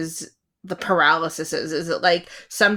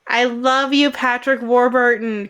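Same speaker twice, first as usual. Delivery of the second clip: calling out; 4 semitones higher, 6 dB louder than usual. Pretend. Mock plea to an absent actor.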